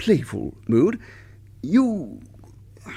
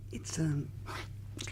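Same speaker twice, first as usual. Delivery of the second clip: soft unvoiced hush; whisper; 2 semitones higher, 15 dB quieter than usual. Husband whispers a delicate matter to his wife. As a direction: drop to a whisper, embarrassed.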